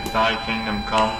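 usual speaking voice

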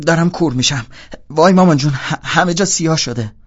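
loud, high, hammed-up cry for mummy; mock blind panic. Teasing his roommate by playing blind, loud mock panic.

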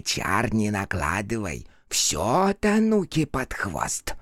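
slow, drawn-out creature grumble. Acted. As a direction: drag the curse out like a spirit. Персонаж бормочет проклятие. (медленный голос духа)

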